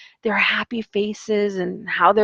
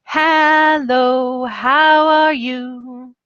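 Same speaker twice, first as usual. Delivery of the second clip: higher and slow, drawn out like an announcer; microphone-style greeting; 6 semitones higher, 3 dB louder, much slower than usual. Shown as a demonstration. Teacher with a microphone greeting students in a slow, stretched, higher voice. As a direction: stretch it into the mic for the kids. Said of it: "I'll be like this".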